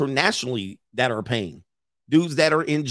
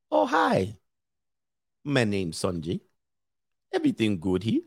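softer, low-voiced Indian accent after a squeaky hello; Sanjay; 4 semitones lower, 4 dB quieter than usual. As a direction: play a sheepish comic character with an Indian accent. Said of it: "Sanjay and them".

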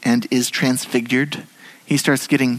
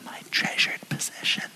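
whisper; 11 dB quieter than usual. Acted. Echoing God's words softly.

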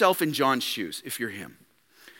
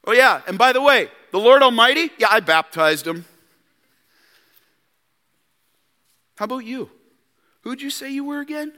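gloating brag; 7 semitones higher, 4 dB louder than usual. Retold or imitated. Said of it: "could have pulled so much rank".

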